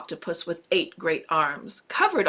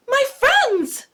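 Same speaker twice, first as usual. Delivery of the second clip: overjoyed fish; 6 dB louder than usual. Acted. Fish astonished speaks.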